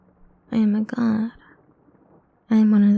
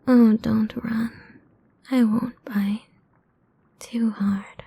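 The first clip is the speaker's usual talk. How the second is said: ordinary delivery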